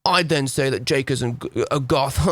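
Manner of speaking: put-on self-important goth voice; a hammed-up haughty British goth; mock pompous British goth. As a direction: lampoon a smug British goth. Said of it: "forward and be like".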